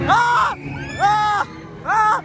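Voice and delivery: high screams "Ah"; ride screams. Real, not acted. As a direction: scream at each drop. Cris sur le manège. (cris aigus de manège)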